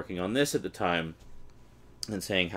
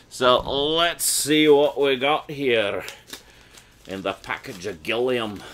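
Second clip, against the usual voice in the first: slow, louder hammed-up Scottish accent; bad Scottish accent; 4 semitones higher, 5 dB louder, slower than usual. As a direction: ham up a Scottish brogue badly. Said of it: "cringing at my horrible accent".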